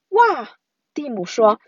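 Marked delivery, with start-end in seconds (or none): 0.1-0.6 high "wow" of wonder; amazed. A narrator's reading. Brother feeling his throat vibrate, amazed. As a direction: exclaim high, full of wonder.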